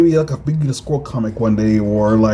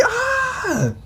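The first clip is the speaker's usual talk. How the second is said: startled scream; 5 dB quieter than usual. Acted. Character grabbed by alien.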